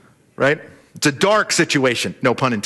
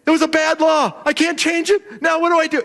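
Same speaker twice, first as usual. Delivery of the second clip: much higher, frantic pleading; a king caught in his own law, flustered; king panicking to Daniel over the bad law; panicked king; 11 semitones higher than usual. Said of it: "he is panicked".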